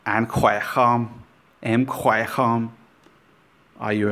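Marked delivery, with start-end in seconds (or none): 0.1-2.7 Anh khỏe không said slowly with a glide; Vietnamese phrase demo. Shown as a demonstration. Teacher models the greeting for learners, slowly. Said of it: "you might hear people say".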